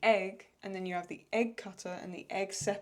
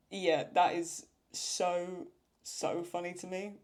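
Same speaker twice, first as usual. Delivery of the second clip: ordinary delivery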